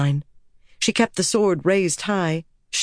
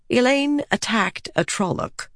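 plain reading voice

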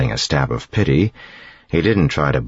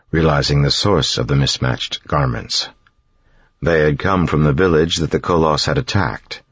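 ordinary delivery, nothing marked

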